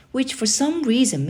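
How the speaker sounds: usual speaking voice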